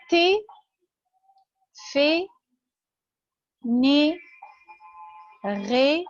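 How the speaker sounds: "تي، في، ني، غي" each ending in a closed French é; isolated drill syllables "تي، في، ني، غي", said té, fé, né, ré; teacher's é drill on "تي، في، ني، غي", spaced out. Shown as a demonstration. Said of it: "اللي هو الصوت إيه".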